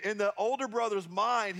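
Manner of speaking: usual speaking voice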